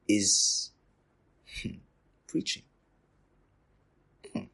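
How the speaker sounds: usual speaking voice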